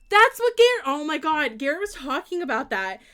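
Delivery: sudden loud, high outburst; excited recognition. Real, not acted. Streamer recognizing what Garrett talked about, loud excited outburst. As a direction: burst out loud as it clicks.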